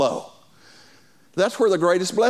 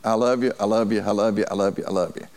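rattled off lightly; 10 semitones lower than usual. Retold or imitated. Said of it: "one of my key phrases is I say".

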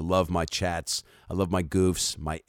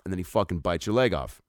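ordinary talk, nothing marked

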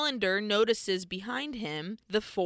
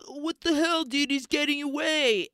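high-pitched, whiny outrage; indignant protest; 6 semitones higher than usual. Acted. Walker complains his prey escapes, high indignant protest. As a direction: protest like a thwarted hothead.

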